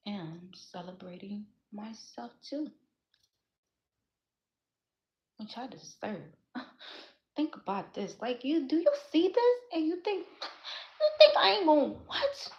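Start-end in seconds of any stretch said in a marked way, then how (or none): 0.1-6.2 whisper. Real, not acted. Quiet self-praise after sincere speech. 8.2-12.5 rising indignation. Real, not acted. Defiant challenge to doubters.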